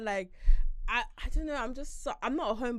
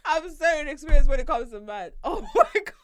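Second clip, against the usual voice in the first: high, loud embarrassed laughter; laughing; 7 semitones higher, 12 dB louder than usual. Real, not acted. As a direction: giggle through the confession.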